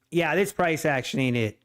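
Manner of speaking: normal talking voice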